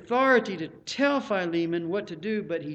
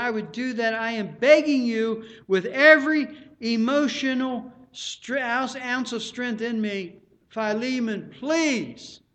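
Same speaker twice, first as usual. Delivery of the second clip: loud, high, heartfelt plea; impassioned begging; 5 semitones higher, 8 dB louder than usual. Acted. Preacher as Paul begging Philemon, impassioned plea. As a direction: beg with everything you've got, full voice. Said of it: "That word beseech is, I beg you".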